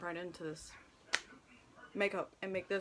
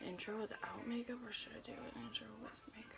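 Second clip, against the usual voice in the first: whispering; 12 dB quieter than usual. Real, not acted. Asking herself aloud.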